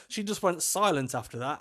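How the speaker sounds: normal talking voice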